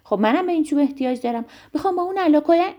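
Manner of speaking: high, fast, loud butting in; impatient, pushy rush of words; impatient interruption. A narrator's reading. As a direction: cut in and rush it out.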